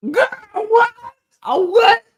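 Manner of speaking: high, loud squeal of disbelief; shocked squeal. Retold or imitated. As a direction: shriek it like you can't believe your ears. Shocked reaction to niece's comeback.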